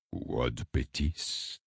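low, very slow hushed question; hushed, drawn-out Latin; whispered. A narrator's reading. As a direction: ask it slowly under your breath. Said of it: "chuchota le cardinal".

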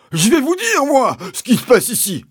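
very high, loud screech; Mister Hazel crying out. A narrator's reading. Landowner rushing at the policeman, crying out shrilly. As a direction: shout it in high-pitched outrage. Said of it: "s'est écrié mister Hazel".

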